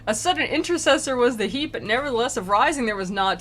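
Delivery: normal talking voice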